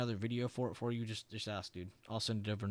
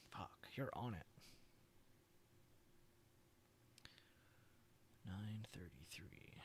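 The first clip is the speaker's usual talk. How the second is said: softer whispered aside, slow at the end; 2 semitones lower, 18 dB quieter, slower than usual. Real, not acted. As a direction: whisper to yourself. Reading entries under breath.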